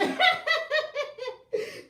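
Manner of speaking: high laugh that climbs; rising laugh. Real, not acted. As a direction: giggle up and up. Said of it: "Y'all got me cracking up here".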